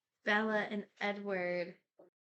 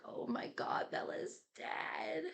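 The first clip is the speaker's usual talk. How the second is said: mock panicked wail; 5 semitones higher, slower than usual. Alice panics over Bella's death.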